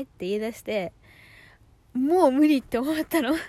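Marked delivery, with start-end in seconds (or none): 1.9-3.4 loud, high cry of exasperation; exasperated outburst. Real, not acted. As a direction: blurt it out, fed up. Bursts out recalling her breaking point.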